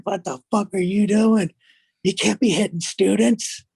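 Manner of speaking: high, loud coach yelling; mock outraged coach shouting at a colleague; angry coach. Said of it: "He grabbed Stokes and was like".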